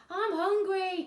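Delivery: high, loud hungry wail; desperate cry. A narrator's reading. Cyril cries out to Bruce, high and loud. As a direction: cry out in need. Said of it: "cried Cyril".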